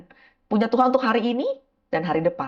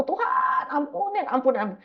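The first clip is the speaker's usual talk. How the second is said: higher, airy plea for mercy; begging forgiveness; 8 semitones higher than usual. Retold or imitated. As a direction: beg God for forgiveness, half-breathed. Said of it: "aku minta ampun".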